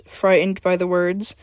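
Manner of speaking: plain reading voice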